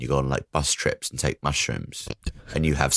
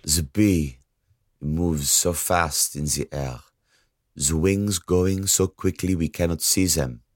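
pretentious poet reading. Pretend. Reading the bad poet's verse.

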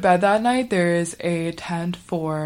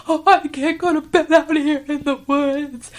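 high-pitched mock bawling; crying; 9 semitones higher, 3 dB louder than usual. Crying girl refuses bed in the woods, high mock wail. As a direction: whine it like a sobbing kid. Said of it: "crying harder and harder".

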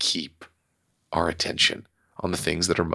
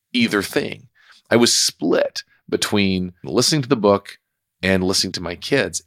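ordinary talk, nothing marked

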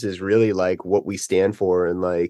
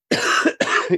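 rough unvoiced cough; a real hacking cough; chesty cough. Real, not acted. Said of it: "I'm coughing the whole thing".

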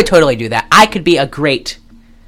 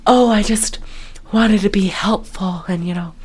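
soft, demure mock-housewife voice; soft-spoken; 7 dB quieter than usual. Wife wanting to be helpful, mock soft-spoken. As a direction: play her meek and softly spoken. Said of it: "she's like Mrs. Soft-spoken now".

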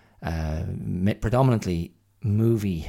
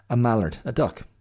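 higher and louder, rising at the end; bright clarification; 5 semitones higher, 5 dB louder than usual. Real, not acted. Telling listeners what a mallard is, louder and higher. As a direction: explain the word with a lift.

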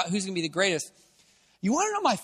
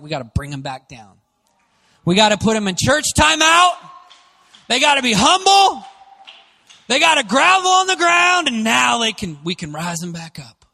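a hint of preachy scolding, rising to a higher, louder mock shout; mock stern church voice; 6 semitones higher, 8 dB louder than usual. Preacher mocking church leaders keeping confident people down, mock scolding. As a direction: sneer at the church's put-down, louder and higher as it goes.

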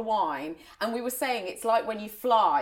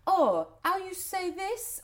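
high, slow imitation of a French girl; French accent; 9 semitones higher, slower than usual. French visitor asking the family a word, French accent. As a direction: ask it slowly in a mock French accent. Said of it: "Mum had these French friends".

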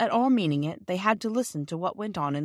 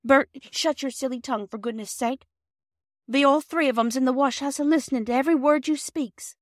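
higher, urgent hushing; anxious Mrs Perks; 7 semitones higher, 3 dB louder than usual. A narrator's reading. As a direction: hush the husband in alarm. Mrs Perks hushing her husband.

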